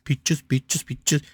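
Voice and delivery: soft, playful little sing-song filler; kid mode. Pretend. Grandparent filling a computer delay, playful kid mode. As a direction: babble it quietly like playing with toddlers. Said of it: "I'm still in kid mode".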